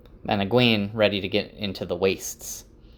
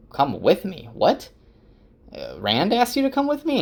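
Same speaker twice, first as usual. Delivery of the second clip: confused high-pitched double take; baffled; 11 semitones higher than usual. Acted. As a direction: pitch leaps high in disbelief. Perrin baffled at Gaul's offer.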